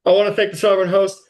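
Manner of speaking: quick hammed-up victory speech; champion's acceptance speech. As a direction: rattle off thanks like a winner at the mic. Mock athlete's acceptance speech.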